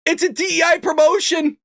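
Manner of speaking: ordinary talk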